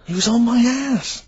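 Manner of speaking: high, slow mocking tough-guy line. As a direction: mimic a wannabe hard man, drawn out. Mock repeats the overheard phrase.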